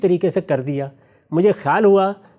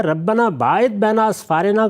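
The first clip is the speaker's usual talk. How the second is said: slow recited verse, each word drawn out; Quranic recitation; 3 semitones higher, slower than usual. Shown as a demonstration. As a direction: recite it slowly as in prayer. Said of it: "تو اب اس نے کیا کیا". (آہستہ تلاوت کا انداز)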